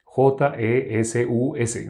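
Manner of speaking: "J E S U S" spelled letter by letter, slowly. Shown as a demonstration. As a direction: spell "J E S U S" out one letter at a time. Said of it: "en vez de decir Jesús, dijéramos". ("J E S U S" deletreado despacio, letra por letra)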